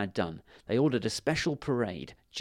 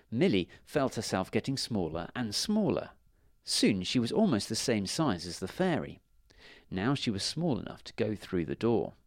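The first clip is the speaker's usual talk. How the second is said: usual speaking voice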